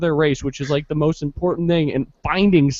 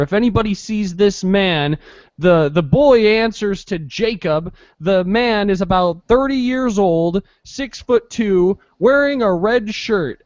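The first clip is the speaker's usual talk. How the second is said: slow, higher mock emergency broadcast; radio alert voice; 4 semitones higher, slower than usual. Mimicking a radio emergency message, slow announcer read. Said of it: "on the radio they'll do".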